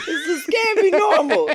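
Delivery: high laughing protest; laughing disbelief. Real, not acted.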